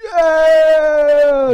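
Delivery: long, high, loud imitated cheer; crowd cheer. Irish fans erupting in the pub, long loud cheer. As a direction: roar it out like a pub full of fans. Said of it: "the Irish fans are like".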